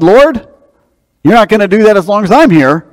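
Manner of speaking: a preacher voicing Peter's outburst at Jesus; Peter protesting. To Jesus, Peter bursts out in a high, loud protest.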